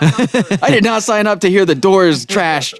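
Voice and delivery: high-pitched mock-outraged rant; an outraged fan yelling at the radio, hammed up; screaming irate listener. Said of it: "someone's pulling over ... and screaming".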